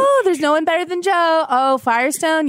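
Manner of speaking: exaggerated sarcastic gushing about Joe; mocking impression. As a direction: ham up the friend's fake sweetness. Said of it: "please don't do that impression of my face".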